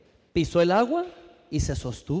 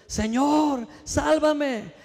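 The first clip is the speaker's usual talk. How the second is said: higher, pleading mock cry for help; sinking and calling out, pitched up; 7 semitones higher, much slower than usual. A narrator's reading. Peter sinking in the sea, high desperate cry. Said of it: "dando voces, gritando".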